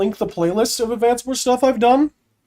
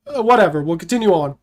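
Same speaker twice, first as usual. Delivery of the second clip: ordinary delivery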